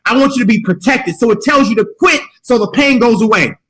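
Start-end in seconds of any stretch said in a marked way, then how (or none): 0.1-1.2 higher, coaxing inner voice; a higher pleading inner voice; voice of the mind. Acted. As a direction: play the mind talking you out of it. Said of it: "The mind says".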